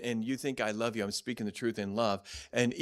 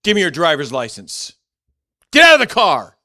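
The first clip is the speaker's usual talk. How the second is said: loud barked order rising higher; harsh police command; 5 semitones higher, 17 dB louder than usual. Retold or imitated. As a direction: bark it like a rough cop.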